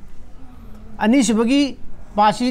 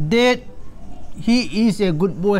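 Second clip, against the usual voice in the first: slow reading; much slower than usual. Shown as a demonstration. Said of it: "ꯍꯥꯏꯕ ꯁꯔꯨꯛ ꯑꯃꯅꯦ".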